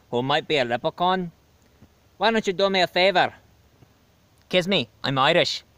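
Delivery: put-on chirpy stage-Irish leprechaun accent; hammed-up Irish leprechaun host; O'Lucky's Irish brogue. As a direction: play a cheeky Irish leprechaun with a thick brogue.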